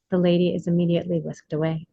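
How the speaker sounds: ordinary delivery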